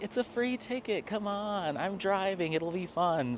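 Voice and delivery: high, wheedling plea easing down; coaxing. Retold or imitated. Coaxing the housemate to take a free ticket, wheedling. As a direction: re-enact talking someone into it. Retells coaxing Doug to come along.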